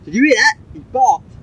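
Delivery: delighted cry that the dog barked; excited. Real, not acted. Owner delighted the dog barked, high excited.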